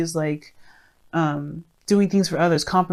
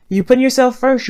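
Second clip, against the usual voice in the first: higher and louder, punching it out; emphatic; 7 semitones higher, 7 dB louder than usual. Real, not acted. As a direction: declare it boldly to the listener. Emphatic encouragement to listener.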